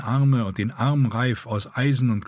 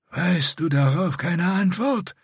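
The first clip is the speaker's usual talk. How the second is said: higher, slow, strained rasping; painful rasp; 9 semitones higher than usual. A narrator's reading. Scarred Bretan scolding Dirk, higher slow strained rasp. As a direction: force every word out painfully. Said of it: "fragte das Narbengesicht".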